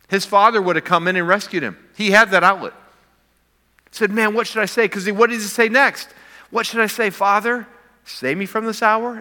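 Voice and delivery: high, loud and driving sermon voice; full-force preaching. Acted. Pastor driving home the point, preaching at full force. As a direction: preach at the top of your voice.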